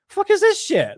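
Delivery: high incredulous squawk; disbelieving outburst. Retold or imitated. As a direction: blurt it out in disbelief. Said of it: "I'm just like".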